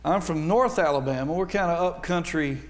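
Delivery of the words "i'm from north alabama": normal talking voice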